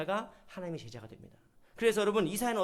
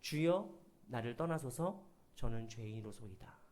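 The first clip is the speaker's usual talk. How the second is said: Peter's humble plea; 9 semitones lower, 7 dB quieter than usual. Retold or imitated. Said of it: "베드로가 어떻게 반응한 줄".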